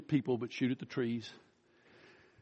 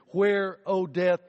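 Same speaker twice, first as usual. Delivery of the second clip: slow, lifted, louder Where, O death; rhetorical cry; 5 semitones higher, 4 dB louder, slower than usual. Acted. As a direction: call out the challenge to death.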